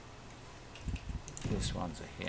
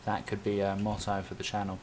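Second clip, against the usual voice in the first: ordinary delivery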